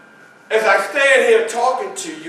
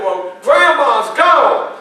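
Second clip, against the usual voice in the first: slow, louder, higher taunting call; brother hollering; 6 semitones higher, 7 dB louder, slower than usual. Retold or imitated. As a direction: drag out the bad news.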